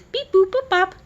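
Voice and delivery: high, loud beep boop noises; button beeps. Retold or imitated. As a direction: chirp the button sounds way up high. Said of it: "press a few buttons".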